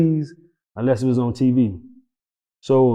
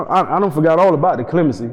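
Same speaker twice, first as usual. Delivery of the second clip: ordinary talk, nothing marked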